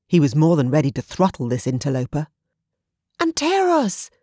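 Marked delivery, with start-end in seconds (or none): 3.2-4.1 high call up the cliff, falling; shout. Acted. Olivia on the beach shouting up to Anteros, high call. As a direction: call his name up to him. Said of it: "Olivia shouted from below".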